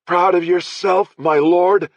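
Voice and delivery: high, slow, loud and spiteful; spat out. Acted. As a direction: spit my lord at him. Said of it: "He spat the last two words".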